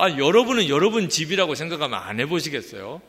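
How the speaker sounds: loud challenging appeal to the listeners; emphatic appeal. Real, not acted. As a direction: push the question at the crowd. Rhetorical challenge to congregation.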